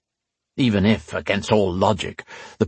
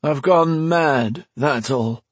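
dull, lifeless; 6 semitones higher, much slower than usual. Acted. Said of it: "in a dull, lifeless voice".

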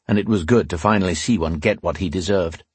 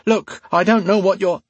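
alarmed; 12 semitones higher, 4 dB louder than usual. A narrator's reading. Alesh alarmed.

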